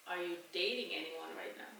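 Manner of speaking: ordinary talk, nothing marked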